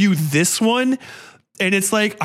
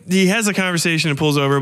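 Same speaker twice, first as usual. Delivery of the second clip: normal talking voice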